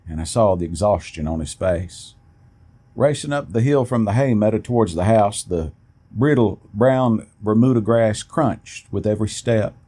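usual speaking voice

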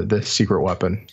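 ordinary talk

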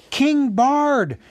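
high, loud acclaiming chant; crowd shouting. A narrator's reading. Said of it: "they shouted".

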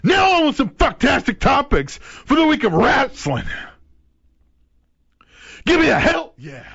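loud high wrestling hype call; hype announcer. Acted. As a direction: shout it to pump up the crowd.

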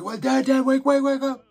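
high, loud frantic shout to wake him; panicked yell. Retold or imitated. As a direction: scream at your sleeping dad to wake up. Waking dad during near crash.

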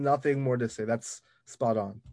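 ordinary talk

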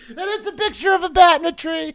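high, squeaky voice through laughter; helpless laughter. Real, not acted. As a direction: barely get it out while cracking up. Cracking up at own meme.

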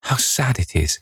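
high wistful murmur, falling away; sad murmur. A narrator's reading. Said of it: "murmured Dorian Gray".

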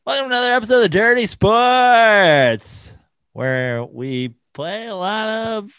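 high, loud show intro; radio DJ voice. Retold or imitated. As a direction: announce it like a hyped radio host. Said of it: "sound like a morning radio D J".

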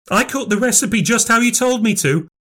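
high-pitched, upset protest; defensive cry. A narrator's reading. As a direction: cry out that you are blameless. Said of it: "cried Nan".

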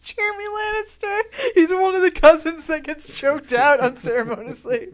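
high, loud, laughing announcement; hammed-up character introduction through laughter; mock heroic introduction. Co-host inventing Jeremy Lannister, mock announcement. Hammed-up joke on a misspoken name.

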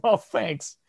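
a sarcastic little thank-you at a tiny payout. Retold or imitated. Sarcastic reaction to zero earnings.

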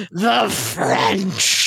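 hammed-up drunk slur; drunken Orson Welles. Co-host recalling wine ad outtakes, imitating a drunk actor. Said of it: "He's totally hammered".

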